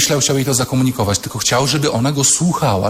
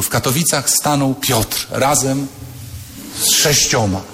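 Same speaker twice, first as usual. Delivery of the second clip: mock scripture reading. Shown as a demonstration.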